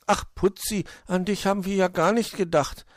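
high-voiced regretful hare; sorry and sad. A narrator's reading. Hoppel realises Putzi was forgotten, sorry and high. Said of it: "war Hoppel traurig".

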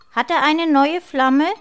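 put-on high, loud, cheeky child voice; chattering little sister. A narrator's reading. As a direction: butt in as a nosy little girl. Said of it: "plapperte Lena dazwischen".

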